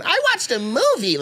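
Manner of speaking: high, innocent mock reply; high girlfriend voice. Mimicking his girlfriend's high reply on the phone. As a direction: play her answer up high and airy.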